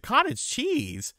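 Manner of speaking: high, drawn-out disbelief; mock horrified echo; slow incredulous repeat. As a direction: stretch it out in shock. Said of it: "I said".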